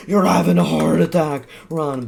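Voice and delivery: loud high mock panic; mock alarm. Playground prank on other kids, in loud mock alarm. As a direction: fake an emergency, loudly.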